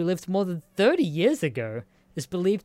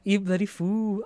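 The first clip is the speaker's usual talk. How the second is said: imitating the duck; 4 semitones higher than usual. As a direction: mimic the duck's muttered curse. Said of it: "it does sound like that".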